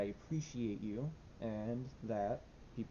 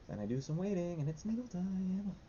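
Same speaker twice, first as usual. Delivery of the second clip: nervously playful, pitched way up; mock-cheery dread; 9 semitones higher than usual. Real, not acted. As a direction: lift the pitch and stretch the ending. Anxious, mock-announces the dreaded needle time.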